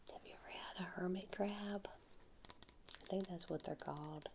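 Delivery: soft ASMR whisper over tapping; whisper with nail taps. Real, not acted. ASMR whisper showing coral shells.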